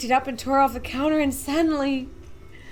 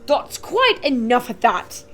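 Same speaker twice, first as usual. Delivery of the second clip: firm, louder; 7 dB louder, slower than usual. A narrator's reading. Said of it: "said the shopkeeper".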